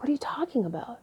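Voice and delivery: breathy hushed disbelief; whisper. Retold or imitated. Answering her boyfriend in a stunned hushed whisper. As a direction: whisper it, taken aback. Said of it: "I was like".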